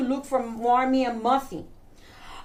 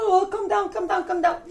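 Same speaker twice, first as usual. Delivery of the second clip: raised-pitch calming plea; hurried soothing; 6 semitones higher, faster than usual. Acted. Listener interrupts the bug girl's wail, urgent soothing. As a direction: try to hush a wailing child.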